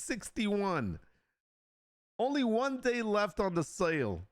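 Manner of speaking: high, hammed-up TV salesman pitch; cheesy salesman. Streamer hamming up a sale of his own art, as a cheesy pitchman. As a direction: oversell it like a bargain-bin ad man. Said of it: "I used to be such a terrible salesperson".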